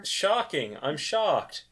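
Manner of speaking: deadpan, unmoved fake shock; mock shock. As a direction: feign shock in a low-key, everyday voice. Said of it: "This is my shocked voice".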